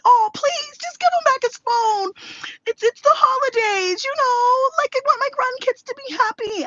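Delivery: very high, loud imitation of a begging grandma; hammed-up pleading on the grandkid's behalf. Grandma begs daughter to return the phone, hammed-up pleading.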